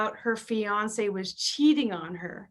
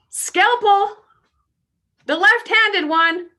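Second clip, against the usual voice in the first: loud, higher mock surgeon barking for a scalpel; commanding surgeon; 10 semitones higher, 5 dB louder, slower than usual. Imagining a left-handed surgeon in the operating room, mock commanding call.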